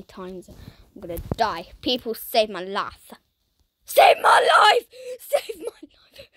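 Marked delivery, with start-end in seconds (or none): 3.8-6.3 loud, high, slow hammed-up wail; mock cry for help. Pleading with other players in the game, hammed-up wail. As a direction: beg the other players dramatically.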